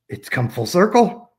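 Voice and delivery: retold gasp of wonder as it clicks; amazed realization.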